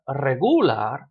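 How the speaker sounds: "regular" in Spanish as re-GU-lar, drawn out; stress on second syllable. Shown as a demonstration. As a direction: stretch and hit the GU of "regular". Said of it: "which syllable to stress".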